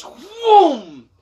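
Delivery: high, loud imitated whoosh; whooshing noise. Telling viewers of the near miss, a loud high whoosh sound. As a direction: mimic something rushing past. Washing machine whooshes past.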